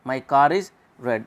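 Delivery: "car is" slowly, with the R pronounced; linking R. Shown as a demonstration. Teacher shows that R must be spoken here, linking R. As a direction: carry the R of "car" into "is". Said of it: "वहाँ पे रे बोलना पड़ता है".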